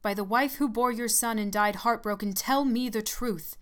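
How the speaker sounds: put-on old nurse demanding the truth from the dying man; commanding. A narrator's reading. Second demand, stronger.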